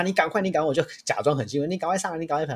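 mock excited urging to the kid; fake excitement. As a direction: pretend to be thrilled to push him on.

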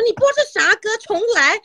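high, exasperated complaint; annoyed masseur. Retold or imitated. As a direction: scold the speaker like a fed-up masseur. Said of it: "按摩师傅还说".

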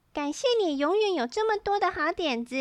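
high-voiced sister's thanks; little sister character. A narrator's reading. Sister Daisy thanks Polly.

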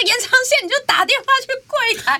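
high, strained exasperation; shrill-edged disbelief at a simple fix; exasperated retort. Retold or imitated. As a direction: she retells telling him to call the front desk, exasperated. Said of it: "我说".

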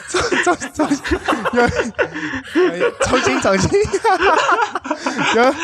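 stammering through laughter; laughing. Real, not acted. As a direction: try to restart while still giggling. Restarting the joke while laughing.

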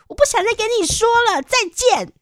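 high, loud and fast huffy brush-off; angry huffy retort. Retold or imitated. To the phone scammer, a high, loud, huffy goodbye. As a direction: snap it off and hang up in a proud huff. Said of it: "我就很生气的说".

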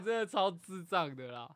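ordinary talk

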